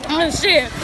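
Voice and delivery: ordinary talk, nothing marked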